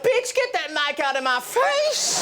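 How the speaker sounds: high, drawn-out indignant plant voice; sassy talking plant. Acted. Plant snapping at the film crew, high and indignant. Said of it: "if the plant went".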